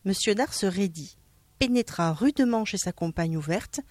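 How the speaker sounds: plain reading voice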